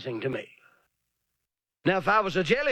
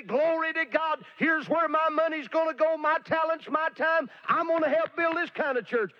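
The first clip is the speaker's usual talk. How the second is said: much higher gushing donor voice; mock enthusiasm; 9 semitones higher than usual. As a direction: ham up the eager pledger. Mocking eager churchgoers.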